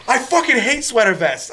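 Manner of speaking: high-pitched mock outraged yell; hammed-up indignant retort; mock scream. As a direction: yell back in a playful screaming match. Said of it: "screaming at each other".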